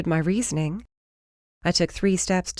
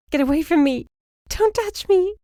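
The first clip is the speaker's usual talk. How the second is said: panicked scream; 13 semitones higher, 4 dB louder than usual. Acted. Panicked victim pushing attacker away.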